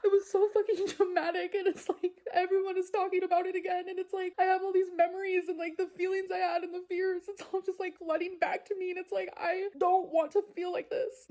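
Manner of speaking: high, strained, upset voice; real distress pushing the voice up; near tears. Real, not acted. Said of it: "so fucking sad right now".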